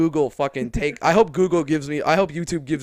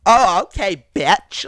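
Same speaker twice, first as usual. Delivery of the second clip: louder, sarcastic okay; mock sarcastic; 4 semitones higher, 9 dB louder, slower than usual. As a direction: brush her off loudly. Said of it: "I was just like".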